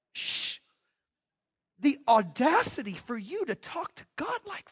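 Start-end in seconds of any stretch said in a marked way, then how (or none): 0.1-0.6 a quick hush through the teeth; shushing. Retold or imitated. Preacher hushing someone who talks boldly to God, quiet shush. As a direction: hush them before lightning strikes.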